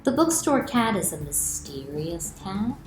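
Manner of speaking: ordinary delivery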